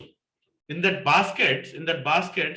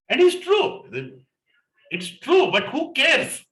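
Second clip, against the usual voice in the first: excited; 12 semitones higher, 4 dB louder, slower than usual. Real, not acted. Laughing at his own scare.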